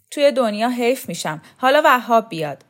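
acted shout. A narrator's reading. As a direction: call it out boldly to the whole company. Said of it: "فریاد کشید".

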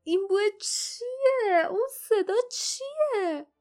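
high, slow, disgusted voice through a held nose; holding the nose. A narrator's reading. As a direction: ask it slowly with the nose pinched. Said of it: "جاسمین که دستش را روی دماغش گذاشته بود". (با دماغ گرفته و صدای زیر و کشیده)

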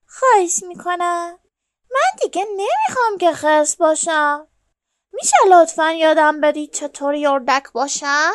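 lightly voiced bear, imploring; pleading bear. A narrator's reading. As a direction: plead with them to let you join. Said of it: "خرسه گفت".

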